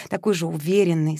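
plain reading voice

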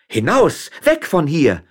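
high, loud order to get out; sharp, indignant shout; utterly outraged. A narrator's reading. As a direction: throw him out with your voice. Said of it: "erwiderte Frau Frasquita mit größter Empörung".

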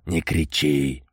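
low grumbled rebuke; grumbling. A narrator's reading. Amadei tells Boris not to shout, grumbling. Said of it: "проворчал Амадей".

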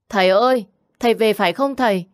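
put-on high, loud, quick child's call; little girl calls to her returning father. A narrator's reading. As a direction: shout to father across the yard.

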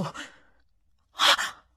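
gasp, then high shocked cry; almost screaming. A narrator's reading. Governess hears of the death, gasping near-scream. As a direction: recoil and nearly shriek it. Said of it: "quasi gridai".

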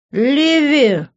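put-on high, drawn-out drunken plea; protesting. A narrator's reading. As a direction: whine the name in protest. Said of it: "попытался опротестовать моё решение".

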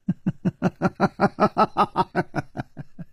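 a short icy laugh, falling away; cold scornful laugh. A narrator's reading. Woman scoffing at his name, cold laugh. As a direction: laugh at him with contempt. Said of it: "冷笑起来".

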